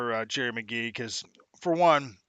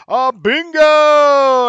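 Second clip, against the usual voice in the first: high, loud, long-held BINGO; game-show announcement; 12 semitones higher, 15 dB louder, much slower than usual. Pretend. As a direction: belt out bingo like a hype announcer.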